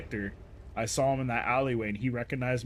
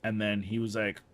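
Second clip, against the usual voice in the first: ordinary talk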